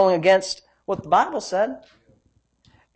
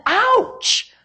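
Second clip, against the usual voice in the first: very high, hammed-up ouch; theatrical high-pitched wince; mock pained ouch; 14 semitones higher than usual. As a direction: yelp as if stung by the words. Mock reaction to the third blow.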